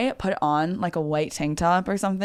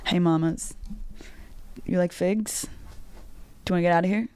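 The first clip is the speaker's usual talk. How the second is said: mock smooth flirt; 4 dB quieter than usual. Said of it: "I'll be like this".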